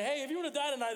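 high, loud mock teen pitch; high, loud mock-eager street pitch; mock eager question. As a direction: ham up the eager kid asking. Teens quizzing Italians, mocked.

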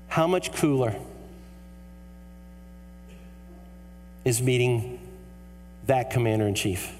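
low, measured sermon delivery with long pauses; solemn, heartfelt preacher's appeal; earnest preaching. Real, not acted. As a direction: bring it down low and let each phrase land.

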